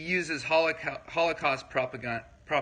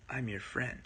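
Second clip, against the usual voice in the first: much softer, words sinking under the breath; quiet flat reassurance; 3 semitones lower, 11 dB quieter than usual. Shown as a demonstration. Contrasting body language for viewers, soft unconvincing friendly line. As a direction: mutter it as if you don't mean it. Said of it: "But if I say".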